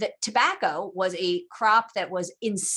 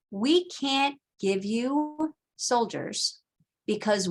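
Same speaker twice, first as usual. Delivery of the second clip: slow, deliberate reading of the quote; quoting the delegates slowly and gravely; read direct quote; 2 semitones higher, slower than usual. Shown as a demonstration. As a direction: read it out slowly, letting each phrase land. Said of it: "and this is a direct quote".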